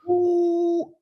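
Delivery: long high-pitched "ooh"; sustained high hoot of approval; impressed ooh. Real, not acted. Reacts to a striking phrase.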